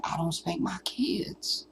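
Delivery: whispered, slow prim quote; mocking whisper. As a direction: whisper it like a dainty confession.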